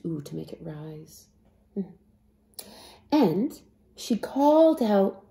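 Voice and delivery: ordinary delivery, nothing marked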